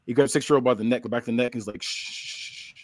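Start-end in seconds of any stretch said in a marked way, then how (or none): 1.8-2.7 long, soft "shh"; hushing shush. Acted. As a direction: hush a crying child. Villain hushes a crying child.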